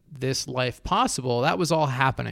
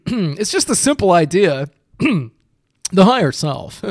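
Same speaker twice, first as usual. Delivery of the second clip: pompous voice; 4 semitones higher, 7 dB louder than usual. Pretend. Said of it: "I just sound like a pompous asshole".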